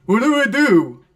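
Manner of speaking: dumbfounded mock voice of a confused safety; clueless player. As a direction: play the defender frozen and lost. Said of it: "just looks at it like".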